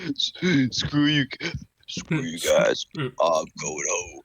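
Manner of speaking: lightly voiced big rich crooner, ending low and slow; a hint of a smooth crooner. Teasing a friend with a rich crooner voice. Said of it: "the voice of [name]".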